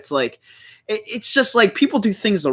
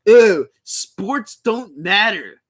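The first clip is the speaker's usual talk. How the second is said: mocking quote; 3 semitones higher, much slower than usual. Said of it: "And then he say".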